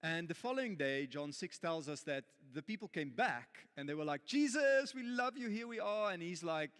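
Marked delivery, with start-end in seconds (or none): 4.2-6.1 high, loud, fawning cry to Jesus; gushing fan. Acted. The crowd fawns over Jesus wanting bread, gushing high and loud. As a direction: gush like an adoring groupie.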